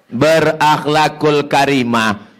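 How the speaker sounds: normal talking voice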